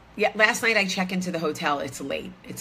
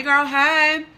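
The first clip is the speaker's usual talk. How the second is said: put-on sassy girlfriend greeting; 9 semitones higher, 8 dB louder than usual.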